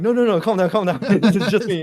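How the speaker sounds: loud, high acted plea to calm down; urgent reassurance. Marine retelling how he calmed his startled friend, urgent reassurance. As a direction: re-enact hurriedly talking someone down, laughing.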